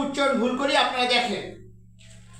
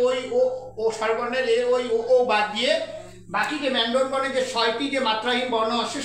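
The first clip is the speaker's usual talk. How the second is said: ordinary delivery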